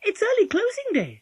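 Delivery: put-on very high, loud child; Polly, testing. A narrator's reading.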